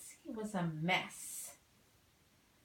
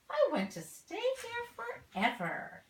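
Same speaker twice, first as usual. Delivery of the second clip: much higher, louder happy bird; delighted exclamation; 14 semitones higher, 5 dB louder than usual. Acted. Missus Bird loving her new nest, overjoyed. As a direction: gush with joy.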